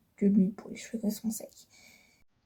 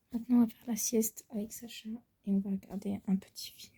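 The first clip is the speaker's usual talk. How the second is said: whispering; 10 dB quieter than usual. Real, not acted. Nap time with Sacha.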